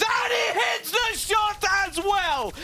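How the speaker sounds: high, slow excited yell; excited shout. Real, not acted. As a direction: scream the clutch call. Caster clutch call.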